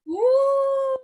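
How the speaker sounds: high whoop of excitement; excited whoop. Real, not acted. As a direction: cheer yourself on stage. Cheer as comic takes stage.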